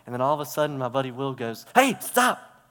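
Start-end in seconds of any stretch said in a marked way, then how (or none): 1.7-2.7 sharp, high warning shout; retold yell. Buddy Will warns him of the rattlesnake, shouting loud and high. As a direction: yell to stop him dead in his tracks. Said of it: "Why are you yelling at me?".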